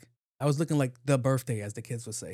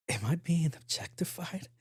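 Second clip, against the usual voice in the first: slow whispered question; slow, breathless whisper; 5 semitones higher, slower than usual. Retold or imitated. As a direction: whisper it in disbelief. Whispered aside to himself.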